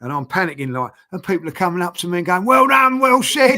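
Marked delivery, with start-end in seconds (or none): 2.4-3.6 high, loud imitation of well-wishers; mock congratulatory shout. People coming up at work, shouting congratulations. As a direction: call it out like an admirer.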